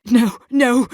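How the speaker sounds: high, distressed refusal; anguished denial. Acted. Haunted figure refusing a vision of two gods, distraught. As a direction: push the vision away in panic.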